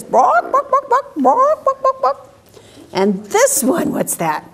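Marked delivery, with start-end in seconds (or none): 0.0-2.2 high squawky hen bawks; excited high chicken noises; hen clucking. Pretend. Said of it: "she says".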